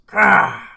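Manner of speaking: a loud, wild growling roar; beast roar. Retold or imitated. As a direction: lunge with a feral roar. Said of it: "Jumps out and goes".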